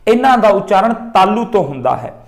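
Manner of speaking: ordinary delivery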